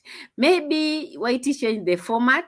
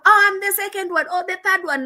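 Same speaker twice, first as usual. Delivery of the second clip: mimicking the hype; 11 semitones higher, 3 dB louder, faster than usual. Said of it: "Oti will come".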